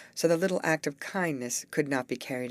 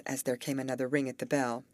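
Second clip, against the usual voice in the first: usual speaking voice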